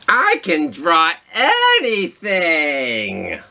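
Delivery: high, slow proud squid voice; giant squid. Acted. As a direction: boast like a cheerful cartoon squid. Squid boasts.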